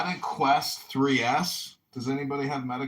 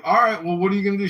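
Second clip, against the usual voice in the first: raised voice; 10 semitones higher, 9 dB louder, much faster than usual. Retold or imitated. Retelling his reply to her.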